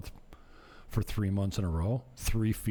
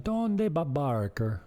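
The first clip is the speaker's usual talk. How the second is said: slow, higher-pitched playful call; mock Spanish question; 7 semitones higher, 3 dB louder, slower than usual. Jokingly acting out his Spanish question.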